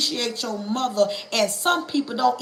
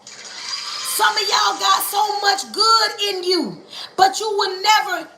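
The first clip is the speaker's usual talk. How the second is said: slow preaching shout; 7 semitones higher than usual. Acted. Preacher calling out slowly to the congregation. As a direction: call it out slowly to the crowd. Repeats line louder, higher.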